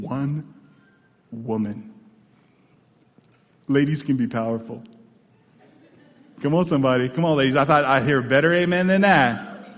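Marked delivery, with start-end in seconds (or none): 6.4-9.7 loud preacher's rallying call; calling for amens. Real, not acted. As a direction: rouse the congregation. Calls congregation for an amen.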